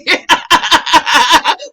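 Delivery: high burst of laughter; loud laugh. Real, not acted. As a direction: crack up. Laughing mid story.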